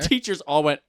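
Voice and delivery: ordinary talk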